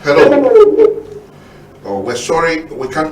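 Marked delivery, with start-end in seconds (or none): 0.0-1.0 loud calling hello. Real, not acted. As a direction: call out to the caller. Host calling to a distorted caller.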